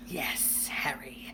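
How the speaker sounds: whispered forced pleasantness. A narrator's reading. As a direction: whisper through a forced smile. Vernon forcing a painful smile.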